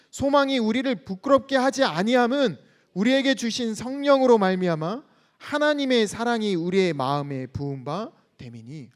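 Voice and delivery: loud, steady unison reading of the verse; scripture reading. Acted. Pastor leading the church in reading scripture, loud and steady. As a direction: read it out together like a congregation. Congregation reads verse five.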